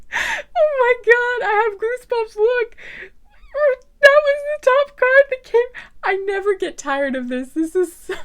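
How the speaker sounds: loud, high squealing excitement with laughs; shrieking with delight over the card; too excited. Real, not acted. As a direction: burst out with real goosebump excitement. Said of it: "I'm being so loud right now".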